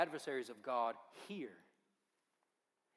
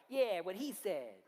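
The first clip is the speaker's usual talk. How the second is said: higher, louder eager sidekick; a lightly voiced eager sidekick; sidekick echo; 5 semitones higher, 4 dB louder than usual. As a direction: chime in like a hanger-on behind the boss. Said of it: "Tobiah's back, and he's like".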